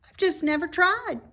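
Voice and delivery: high, loud piggy voice; a young pig, eager and impressed; bright squeaky pig voice; Wilbur the pig. A narrator's reading. Wilbur boasting.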